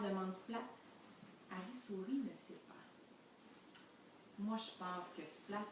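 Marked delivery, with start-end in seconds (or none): none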